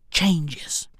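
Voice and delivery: word dropped to a whisper; sudden whispered ending. Acted. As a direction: breathe it out. Dropped to whisper mid-phrase.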